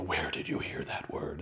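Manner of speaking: low, hushed, slow parental interrogation; mock-menacing parent, soft and drawn out; stern parent. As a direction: imitate a parent quietly demanding an answer. Imitated parent interrogating a child.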